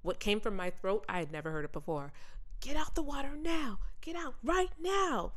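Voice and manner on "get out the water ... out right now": high panicked mock scream; high mock yell to get out of the water; retold scream. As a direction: shriek a warning at a friend. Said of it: "I start screaming".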